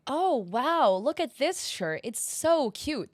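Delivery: high, slow mock delight; sarcastic gushing. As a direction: gush over it with a sarcastic edge. Sarcastic praise of stolen art.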